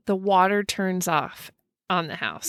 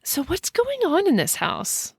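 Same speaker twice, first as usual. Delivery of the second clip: curious; 7 semitones higher than usual. Retold or imitated. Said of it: "asks Jessica".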